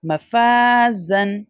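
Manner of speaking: मफाजन slow, जा drawn; stretched jaa. Shown as a demonstration. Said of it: "we should have to ignore it". (मफाजन में जा लंबा)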